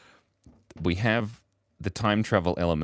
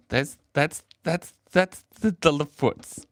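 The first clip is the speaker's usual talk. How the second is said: high, stuttering through laughter; helpless giggling; 7 semitones higher, 3 dB louder, slower than usual. Real, not acted. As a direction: keep restarting as the giggles take over. Giggling at own drawing.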